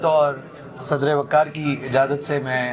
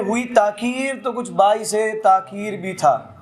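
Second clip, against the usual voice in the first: ghazal recitation; 4 semitones higher than usual. Acted. Poet reciting a ghazal to the mushaira audience, lilting recital. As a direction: savour each line for the gathering.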